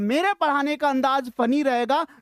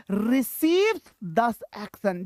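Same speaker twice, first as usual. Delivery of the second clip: slow reading; 4 semitones lower, much slower than usual. Shown as a demonstration. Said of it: "teacher reads definition slowly".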